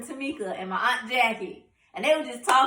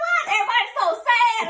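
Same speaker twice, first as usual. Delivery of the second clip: high, loud bewildered thought; acted-out confusion, high and loud; retold puzzlement; 12 semitones higher, 6 dB louder than usual. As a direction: pitch it up in mock bafflement. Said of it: "all I remember kept thinking".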